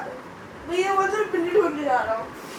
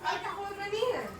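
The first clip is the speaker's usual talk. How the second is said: much softer, under the breath; subdued, quiet query; 13 dB quieter than usual. Real, not acted. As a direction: ask it quietly, barely projecting. First, low asking.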